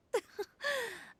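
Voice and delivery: light chuckle; a quick amused laugh; short amused laugh. Acted. Amused at either-or choice.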